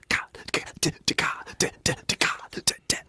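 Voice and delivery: unvoiced ka and d percussion; whispered beatbox clicks. Shown as a demonstration. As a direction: whisper rhythmic ka d sounds. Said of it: "It's just like this".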